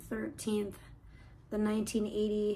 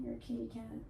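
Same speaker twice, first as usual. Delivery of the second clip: usual speaking voice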